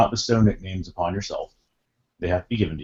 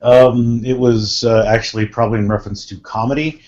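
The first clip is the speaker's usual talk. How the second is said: ordinary talk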